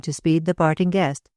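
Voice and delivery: ordinary delivery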